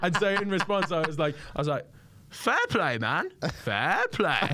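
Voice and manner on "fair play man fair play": high mimicry of the comedian, drawn out; James Acaster impression. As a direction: copy the comedian's voice. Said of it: "That's a really weirdly good impression".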